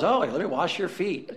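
laughing, breathy-free swoon imitation. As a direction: tease the foot-washing cliché. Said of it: "they're always".

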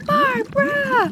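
high, drawn-out scream of a name; frantic call. Retold or imitated. Mom screaming for her daughter underwater, long high frantic call.

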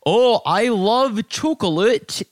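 exaggerated Canadian accent, drawn out; American badly faking a Canadian accent; mock Canadian accent. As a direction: put on a hammy, slow Canadian accent. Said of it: "Your Canadian accent is awesome".

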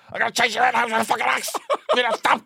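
high, fast mock-shrill threat; imitating mum's threat. Mother threatening her kid with an axe. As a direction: rattle it off like an exasperated mum. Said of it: "my mum once said".

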